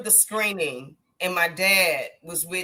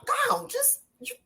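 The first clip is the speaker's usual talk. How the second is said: much higher, louder fatherly voice; imitating her dad; 4 dB louder than usual. Daughter quoting her father telling her to do her job. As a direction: play her late dad, amused and firm. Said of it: "he said".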